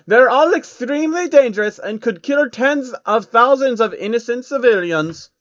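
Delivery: loud, lofty villain showing off his armory; Rothschild voice. Acted. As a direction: boast like a grand, smug banker villain. Rothschild character voice.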